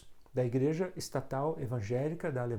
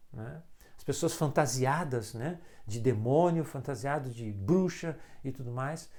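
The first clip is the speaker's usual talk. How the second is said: usual speaking voice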